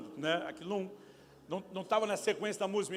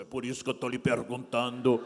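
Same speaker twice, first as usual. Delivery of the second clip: a touch of gruff Lunga, laughing; grumpy Lunga; 7 semitones lower, 4 dB louder than usual. Retold or imitated. Pastor mocks his own impatience, laughing. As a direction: snap back like grumpy Lunga. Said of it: "quase que o Lunga manifestou".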